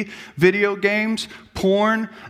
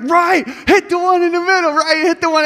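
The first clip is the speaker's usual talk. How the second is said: higher, louder, hyped-up cornermen; excited corner crew; 9 semitones higher, 4 dB louder than usual. Retold or imitated. Cornermen urging Rocky in the ring, hyped and loud. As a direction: pump up the fighter from the corner. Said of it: "And they're like".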